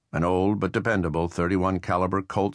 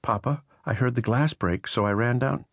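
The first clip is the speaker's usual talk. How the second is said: lightly voiced girl, higher; a hint of a worried young girl, pitched up; young daughter; 6 semitones higher than usual. A narrator's reading. Daughter Jenny calls to father.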